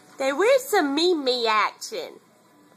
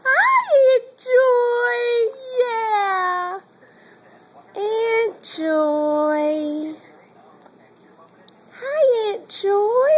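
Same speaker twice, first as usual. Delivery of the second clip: put-on much higher sing-song voice for the baby; baby-talk greeting; 8 semitones higher, 4 dB louder, much slower than usual. Mother prompting baby to greet Mimi and Aunt Joy, high coo.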